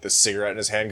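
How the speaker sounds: normal talking voice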